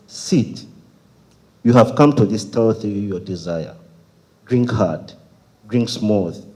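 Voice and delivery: firm, emphatic spoken-word delivery; poem recitation. Acted. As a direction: declaim with pauses between short phrases.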